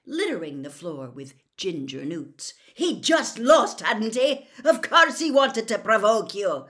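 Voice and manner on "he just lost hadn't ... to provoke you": loud, high, exasperated scolding; angry rhetorical questions at raised volume; furious rebuke. A narrator's reading. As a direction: lay into them, outraged. Shouting teacher continues furious rebuke.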